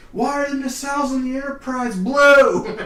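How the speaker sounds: high, loud, slow exasperated cry; mock outraged fan. Imitating an outraged fan complaining to the co-host, loud and high. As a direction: wail it like a betrayed Trekkie.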